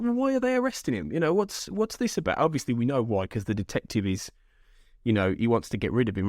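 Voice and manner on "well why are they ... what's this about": high-pitched incredulous questioning; baffled, voice pitched well up; baffled protest. Retold or imitated. Voices own baffled reaction to plot.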